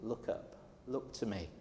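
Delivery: softly whispered God's voice; hushed voice of God calling; gentle whisper. Retold or imitated. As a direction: whisper it as God would, intimately. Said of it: "And he says".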